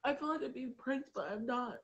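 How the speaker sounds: ordinary delivery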